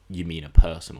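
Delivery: loud, pointed correction; mock-loud correction of a colleague; retold loud retort. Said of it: "and he goes".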